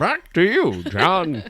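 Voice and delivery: put-on high, loud old newsreel announcer. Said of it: "John sounds like the, uh, the newsreel guy".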